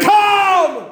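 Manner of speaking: loud, very high, drawn-out cry; climactic preacher's shout. Real, not acted. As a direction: belt the one word out to the congregation. Climax of full-force preaching.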